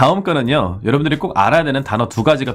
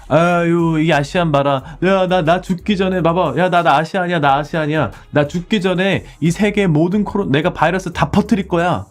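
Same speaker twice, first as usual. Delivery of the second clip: high, loud, sneering mock; 7 semitones higher, 4 dB louder than usual. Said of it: "막 이렇게 얘기를 해요". (유치하게 아시안을 놀리는 높고 큰 목소리)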